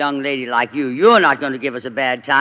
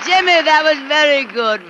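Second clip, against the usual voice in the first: gushing praise; 10 semitones higher, 4 dB louder than usual. Acted. Tallulah gushing over his song.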